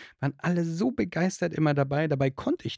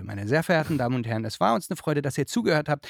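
loud announcer; 3 semitones higher, 7 dB louder, slower than usual. Acted. Joking loud podcast outro.

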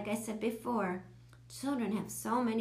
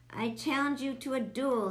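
higher-pitched mock-heroic challenge; crying out; 5 semitones higher, 4 dB louder than usual. A narrator's reading. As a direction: declare it like a boy starting a play duel. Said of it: "he cried".

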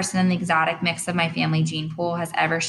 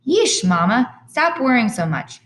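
loud, high teasing; a dad teasing mom, louder and higher; 7 semitones higher, 5 dB louder than usual. Acted. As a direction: tease her to stop worrying.

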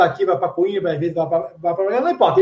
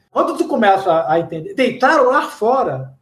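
usual speaking voice

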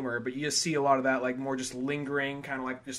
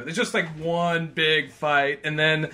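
ordinary talk, nothing marked

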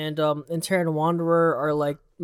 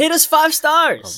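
high hammy shout; 10 semitones higher, 5 dB louder, slower than usual. Pretend. Silly show intro bit.

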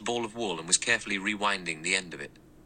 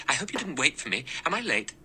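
higher, hurried and apologetic; said quickly, breathless; 8 semitones higher, faster than usual. Acted. Vera arriving late to lunch, higher and hurried. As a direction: rush in a little out of breath. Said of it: "She said quickly".

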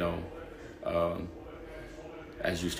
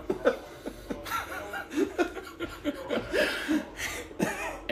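big laugh. Real, not acted. Laughing at his dancing.

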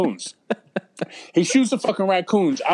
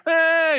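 put-on high, squeamish "eh" of a grossed-out kid; disgusted kid noise; 12 semitones higher, 3 dB louder than usual. Kid running home with doo-doo on his hand, squeamish high "eh". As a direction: squeal "eh" like a kid holding a dirty hand away. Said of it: "you just".

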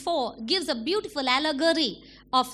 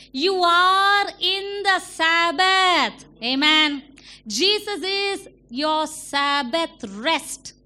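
slow and louder, each word weighted; slow emphatic; 3 semitones higher, 4 dB louder, much slower than usual. Acted. Preacher declares to the church, slow and loud. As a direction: lay every word down.

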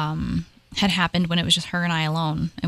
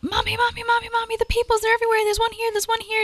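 put-on much higher little-girl voice calling out in fright; frightened toddler; faster than usual. Mother voicing her panicking daughter on the stairs, high frightened child voice. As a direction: squeal it like a panicked small child pointing at ghosts. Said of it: "she starts freaking out".

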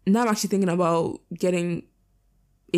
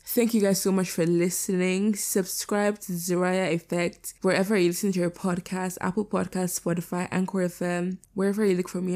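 ordinary talk

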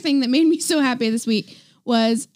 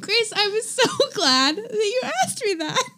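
giggling through the words; laughing; 7 semitones higher than usual. Real, not acted. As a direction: try to stay straight but crack up.

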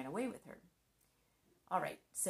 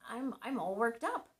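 ordinary delivery

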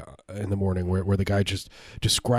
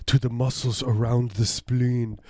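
a hammed-up German villain savoring each word; mock German accent; 4 semitones higher than usual. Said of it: "That's my German Nazi".

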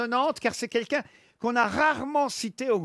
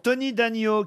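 ordinary delivery

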